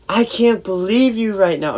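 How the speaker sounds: high-pitched retold outrage; imitated fed-up cousin; indignant scolding. Retold angry cousin scolding him.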